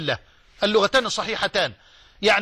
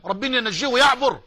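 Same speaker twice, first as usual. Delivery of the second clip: ordinary delivery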